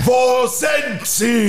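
high, slow roaring demand; shouting Batman. Acted. Batman slamming the Joker, a slow furious shout. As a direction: bellow it at the villain. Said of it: "schreit er wieder".

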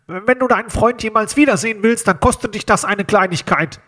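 menacing phone caller disguising his voice; disguised nose-pinched voice. A narrator's reading. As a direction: talk as if holding your nose. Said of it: "als würde jemand die Stimme verstellen".

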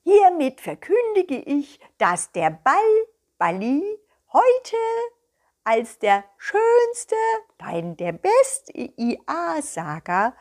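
high, slow, loud solemn announcement; proud proclamation. Acted. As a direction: announce the winner with pride. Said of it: "sagte einer ganz stolz". (stolz und feierlich verkündet, hoch und langsam)

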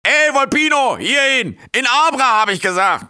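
high, loud roaring call across the square; dragon bellowing. Acted. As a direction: bellow at the little fox like a huge beast.

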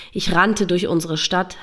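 plain reading voice

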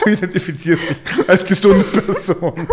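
slow, through laughter; laughing joke. Real, not acted. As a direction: crack up mid-sentence.

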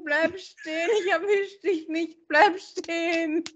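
high-pitched playful calling after someone; mock chase calling. Pretending to chase Bill around a pool, calling out excitedly. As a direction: call out to him as if running after him round a pool.

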